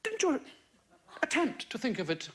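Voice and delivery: put-on lightly posh English accent, father and son; a hint of plummy English, a vicar voicing his boy. Radio vicar retells a chat with his son, light posh English accent. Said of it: "Pretty good English accent".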